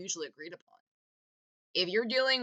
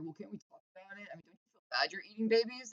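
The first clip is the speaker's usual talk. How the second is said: much softer, fast, timid plea; mock pleading normie; 9 dB quieter, faster than usual. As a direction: rush it out meekly, a naive liberal pleading with a monster. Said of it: "pro-social people are just like".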